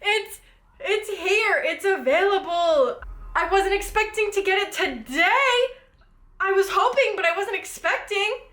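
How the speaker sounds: high laughing excitement; giddy, breathless joy; excited laughing. Real, not acted. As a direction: gush it out through laughter. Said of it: "I'm so excited".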